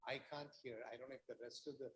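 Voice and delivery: very faint voice, far from the mic; almost inaudible speech; barely audible. Real, not acted. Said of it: "your voice is very low".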